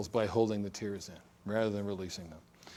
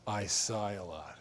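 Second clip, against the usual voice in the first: sighing; 4 semitones lower, 6 dB quieter, slower than usual. Retold or imitated. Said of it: "So people sighing".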